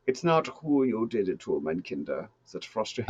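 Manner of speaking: put-on evil German villain accent. German mastermind scolding over the wrong victim, evil German accent. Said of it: "play the whole evil German thing".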